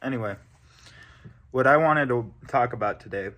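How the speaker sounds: talking with tobacco in mouth; mouth full. Real, not acted. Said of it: "got tobacco in my mouth".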